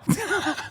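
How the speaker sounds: a real laugh breaking out high; short high cackle of disbelief; high burst of laughter. Real, not acted. Laughing at the tariff flip.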